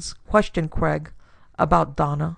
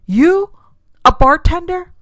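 high, loud, rising shriek of disbelief; incredulous shriek; 12 semitones higher, 9 dB louder, slower than usual. A narrator's reading. Daughter learns her father tended bar, shrieking in disbelief. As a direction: shriek it, pitched way up. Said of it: "Donna shrieked".

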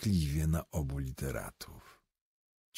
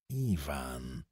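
soft, hushed calling of a name; quiet call; 5 semitones lower, 6 dB quieter than usual. A narrator's reading. Berlioz softly warns the rude poet Iwan, hushed. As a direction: call his name under your breath. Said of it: "cichutko zawołał Berlioz".

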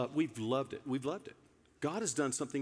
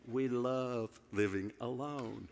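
mock deadpan; 5 semitones lower, 6 dB quieter, slower than usual. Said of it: "I'm just playing".

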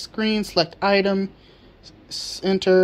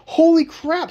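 much higher and louder exclamation; excited; 9 semitones higher, 5 dB louder than usual. Real, not acted. Seeing the bios return, amazed exclamation. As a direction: gasp it out in amazement. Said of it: "I am, holy crap".

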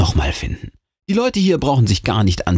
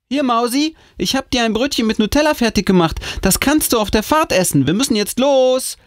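sehr hohe, eilige Mutterstimme; drängelnde Mutter. Acted. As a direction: mit hoher Stimme das Kind zum Aufbruch drängen. Mutter spricht zur Tochter. (hohe, geschäftige Mutterstimme)

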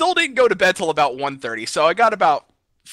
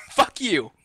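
giggling Fuck you, quieter; laughing curse; 5 dB quieter than usual. Real, not acted. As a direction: let the anger dissolve into laughter on Fuck you. Repeats curse while laughing.

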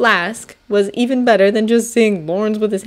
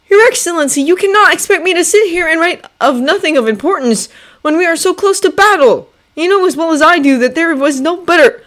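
higher, louder, pleading urgently; agitated young officer; 11 semitones higher, 6 dB louder than usual. Acted. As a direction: push back hard, frustrated.

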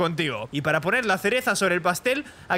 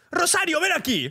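calling out; 7 semitones higher than usual. Acted. Boss calls maid from kitchen.

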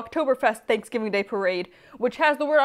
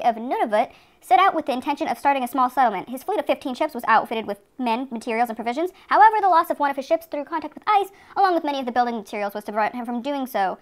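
fast, lofty read-aloud of a textbook passage; formal history read; 5 semitones higher, faster than usual. Acted. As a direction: read it like a grand history text. Reads Wikipedia history aloud.

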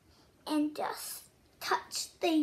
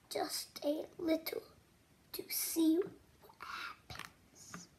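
hushed whisper; 6 dB quieter than usual. Acted. Soft whisper, guiding children to tap the page. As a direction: whisper gently so they lean in.

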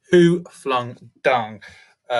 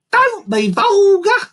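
very high, loud, slow bird voice; squawking vulture; 9 dB louder, slower than usual. A narrator's reading. Vulture rebuking the accusing monkey, squawked.

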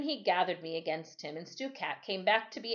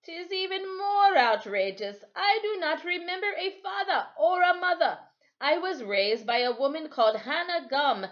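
amused; 9 semitones higher, 5 dB louder than usual. A narrator's reading. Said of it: "deep laughter in his voice".

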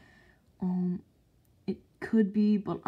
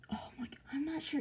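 fast breathless whisper; hushed nervous aside; 7 semitones higher, 11 dB quieter, faster than usual. Real, not acted.

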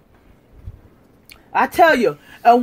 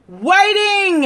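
singsong "Waiting." called out to the viewer; impatient call; 7 semitones higher than usual. Real, not acted. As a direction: demand the answer, pressing hard. Taunting a silent challenger.

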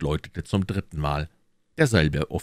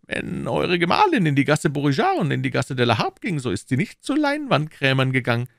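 higher, louder nervous protest; anxious Bonacieux; 11 semitones higher, 4 dB louder than usual. Acted. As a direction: plead his innocence. (ängstlicher Bonacieux)

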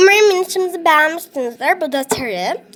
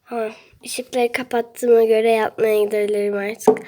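slow, unvoiced bedtime whisper; 8 semitones lower, 7 dB quieter, slower than usual. Real, not acted. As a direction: whisper so no one wakes. Whispering going to bed. (yatmadan önce fısıltıyla)

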